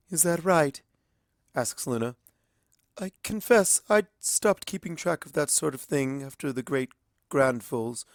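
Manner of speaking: high regal princess voice; a lightly voiced royal, measured; Luna. A narrator's reading. Luna telling Twilight of her lost line, regal.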